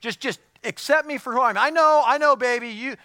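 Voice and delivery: loud, high mock plea from a smelly husband; stinky husband. A pastor mocking a smelly husband to his congregation, loud and high. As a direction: ham up the lazy slob begging for love. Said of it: "a stinky man who's just like".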